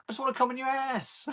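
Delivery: very high comic voice; imitating a stand-up, pitched right up; Mickey Flanagan. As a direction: retell the punchline in a squeaky-high pitch. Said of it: "that Mickey Flanagan bit".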